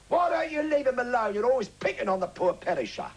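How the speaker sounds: high, loud indignant yell; high-pitched theatrical shout; shouted line. Acted. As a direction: shout it out in protest for the back row. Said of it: "the line I had to shout".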